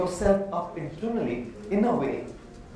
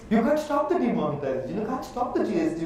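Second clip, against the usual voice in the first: ordinary delivery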